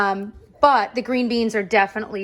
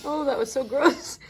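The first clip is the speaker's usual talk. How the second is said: laughing disgust; 5 semitones higher than usual. Real, not acted. Still laughing at disgusting sound.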